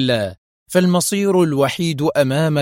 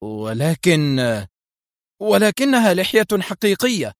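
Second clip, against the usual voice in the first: stammered then rising high in disbelief; shocked cry breaking upward; stunned exclamation; 5 semitones higher than usual. A narrator's reading. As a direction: blurt it out, thunderstruck. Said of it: "هاتفا".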